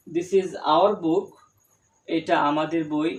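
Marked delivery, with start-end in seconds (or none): 0.1-1.3 slow with "our" pressed a little; teacher pressing "our" in a model sentence; stress on our. Shown as a demonstration. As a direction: lean on "our" and say the sentence slowly. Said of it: "এখানে যদি আমরা our, আহ, শব্দ নিয়ে আসি".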